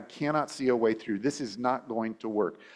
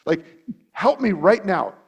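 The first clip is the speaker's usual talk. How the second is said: David's plea; 7 semitones higher, 5 dB louder than usual. Retold or imitated. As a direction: plead with God to act now. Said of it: "but he says".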